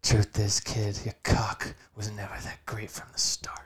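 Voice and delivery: exaggerated whispery anime character; hushed, overdramatic anime antihero; Shadow the Hedgehog. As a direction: half-whisper it with cartoon gravity. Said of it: "the way that he talks is very, uh, exaggerated".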